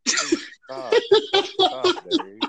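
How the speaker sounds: very high, pleading robot voice; hammed-up high-pitched begging computer; pleading HAL. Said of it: "like on a, uh, two thousand one, A Space Odyssey".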